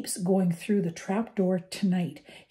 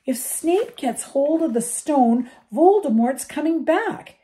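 higher and louder fierce plea; passionate outburst; 5 semitones higher, 7 dB louder than usual. A narrator's reading. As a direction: rage to make them see. Harry's impassioned outburst at friends.

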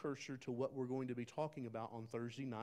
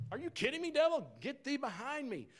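incredulous rebuke; 12 semitones higher, 3 dB louder than usual. Retold or imitated. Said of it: "you'd be like".

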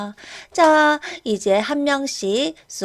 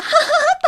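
mocking laugh; 7 dB louder than usual. Mocking teacher.

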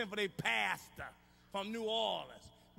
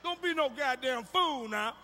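louder, slow and deliberate; loud slow warning; 3 semitones higher, 7 dB louder, slower than usual. Real, not acted. Comic warning the men in the crowd, loud and slow. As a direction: lay it down slowly and loud.

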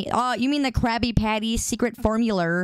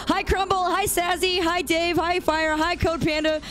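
high, chirpy hellos to each name; bright streamer greetings; 6 semitones higher than usual. Acted. Streamer greeting chat names one by one, high and chirpy. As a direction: greet each viewer cheerfully, pitched up.

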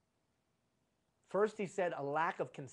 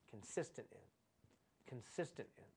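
soft echo; 7 semitones lower, 11 dB quieter than usual. Shown as a demonstration. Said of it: "repeats phrase quietly for emphasis".

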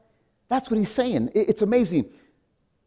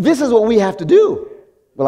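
ordinary delivery

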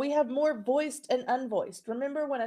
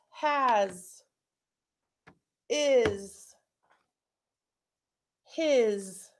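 "Has, is, his" louder, each word alone; for echoing; 3 dB louder, much slower than usual. Shown as a demonstration. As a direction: say each word clearly for the class to echo. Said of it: "echo these words".

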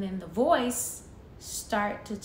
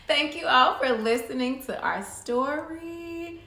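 slow, raised, loud thanks; cheery sign-off; 5 semitones higher, 6 dB louder, slower than usual. Real, not acted. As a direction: draw out a show-closing thank-you. Signing off to listeners.

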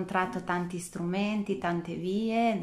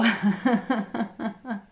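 loud bubbly laugh; loud joyful laugh; delighted laugh; 4 semitones higher, 8 dB louder than usual. Real, not acted. Laughs after the compliment.

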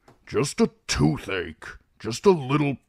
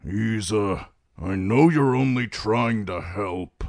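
gruff character voice, now mildly apologetic; contrite; 3 semitones higher than usual. A narrator's reading. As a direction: soften into grudging apology. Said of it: "He was immediately contrite".

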